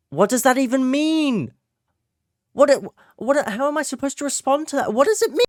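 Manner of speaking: loud, very high-pitched exasperated outburst; baffled yelling. Acted. Reacting to a baffling gif from a friend, baffled yelling. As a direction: yell it high in total bewilderment.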